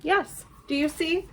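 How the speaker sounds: ordinary delivery, nothing marked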